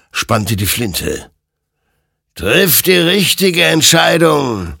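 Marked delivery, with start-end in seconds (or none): 2.3-4.8 warning admonition. A narrator's reading. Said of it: "ermahnte ihn sein Gegenüber".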